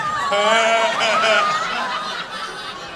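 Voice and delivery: loud burst of laughter; real loud cackle. Real, not acted. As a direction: crack up loudly. Big laugh before reply.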